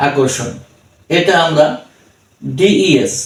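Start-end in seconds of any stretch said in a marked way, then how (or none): none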